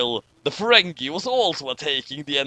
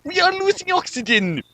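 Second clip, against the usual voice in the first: high, urgent cry of alarm; panicked alarm; 14 semitones higher than usual. Acted. Crewman warning the captain in panic. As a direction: shout it like the air is running out. Crew alarm in space emergency.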